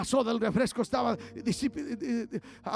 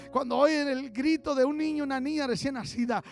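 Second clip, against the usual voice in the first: ordinary talk, nothing marked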